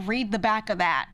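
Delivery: loud mock command; imitating an annoyed father; pissed-off dad. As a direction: order it firmly, fed up. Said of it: "he was getting pissed".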